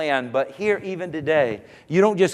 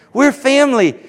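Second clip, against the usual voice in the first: higher and louder, ringing out; loud proclamation; 8 semitones higher, 5 dB louder than usual. Acted. Pastor to congregation, repeating it as a loud proclamation. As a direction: proclaim it to the whole room.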